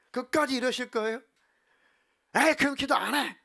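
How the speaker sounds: imitating a high, loud angry outburst; hammed-up shout of defiance; exasperated outburst. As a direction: give up on prayer in a huff. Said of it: "뛰쳐나가시더라고".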